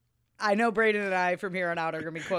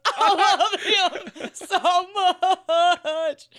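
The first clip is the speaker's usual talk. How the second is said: slow, loud, much higher wailing sob; mock sobbing; 11 semitones higher, 8 dB louder, much slower than usual. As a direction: blubber it out like overwhelmed tears. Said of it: "'cause I'm being loud".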